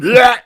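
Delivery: loud high retching bleh; loud gagging sound. Retold or imitated. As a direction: belt out a gag noise. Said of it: "but not making the "bleh" sounds".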